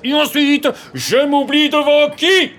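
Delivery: high, very loud, drawn-out shout; furious yell. Acted. Young man challenging his rival, yelling. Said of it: "Hurla-t-il, levant les poings".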